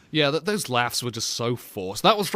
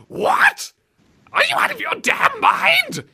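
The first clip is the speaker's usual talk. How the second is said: very high, loud mock shriek; voice gone haywire; 4 dB louder, slower than usual. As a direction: imitate an outraged guard squeaking upward. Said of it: "what the heck's happened to your voice".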